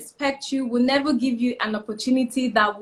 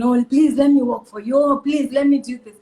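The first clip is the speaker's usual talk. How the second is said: louder, insistent imitation pleading; mock begging; 5 dB louder than usual. Mentor imitating young people who beg for work, mock begging. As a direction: act out someone begging for work. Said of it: "is begging".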